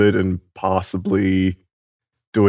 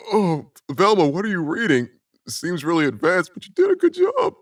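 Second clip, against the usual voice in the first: character voice; 9 semitones higher than usual. Acted. GM character talks to Velma.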